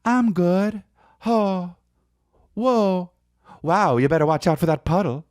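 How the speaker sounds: put-on high old-movie leading lady; fifties movie woman. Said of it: "And then she's like".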